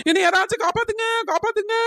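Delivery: high-pitched cry for rescue; acted panic, pitched high; panicked call for help. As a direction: call out desperately to anyone near. Panicking woman calls for rescue.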